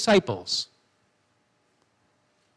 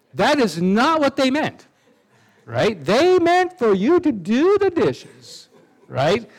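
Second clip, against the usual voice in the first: higher, slower, exasperated stress; 5 semitones higher than usual. Retold or imitated. A teacher spelling out what the parent meant.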